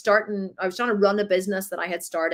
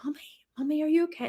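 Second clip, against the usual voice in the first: put-on higher, softer child voice; worried little kids; 6 semitones higher, 5 dB quieter, faster than usual. Kids checking on crying mum, soft and worried. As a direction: gently ask like a small child. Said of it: "they were like".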